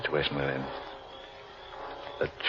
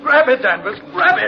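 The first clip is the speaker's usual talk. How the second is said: much higher, loud urgent call; urgent shout; 6 dB louder than usual. Acted. Man shouting to sinking Danvers to stand still, urgent. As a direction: yell orders over the panic. Rescue at the quicksand.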